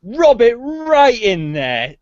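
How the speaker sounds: high, slow, loud mock pain; milk the sting theatrically; hammed-up. Mock painful exaggeration.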